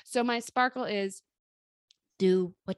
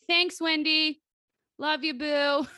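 sarcastic thanks; 6 semitones higher, 4 dB louder, slower than usual. Retold or imitated. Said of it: "I was like".

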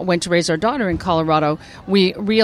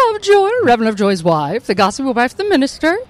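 high-pitched gossipy minister's wife; Helen Lovejoy; 4 semitones higher than usual. Shown as a demonstration. Actress rattling off her characters, gossipy wife voice. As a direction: play the town gossip, pitched up. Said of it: "thank you very much for doing the voices".

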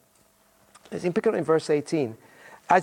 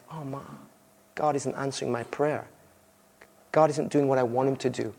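disappointed; 6 semitones lower, 4 dB quieter than usual. Acted. Disappointed believer's inner voice.

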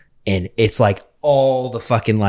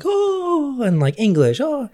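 mock impressed crowd; slower than usual.

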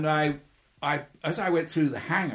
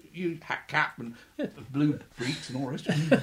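usual speaking voice

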